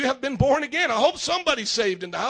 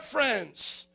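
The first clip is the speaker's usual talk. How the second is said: ordinary talk, nothing marked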